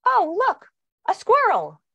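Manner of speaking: put-on suddenly thrilled little girl spotting something; delighted child. Daughter suddenly noticing a squirrel, high and delighted.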